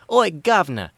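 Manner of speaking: loud, high, cheeky British caricature; mock British accent. Joking about mimicking Afton's accent, mock British accent. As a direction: ham up a British "Oi, governor". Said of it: "making fun of his accent".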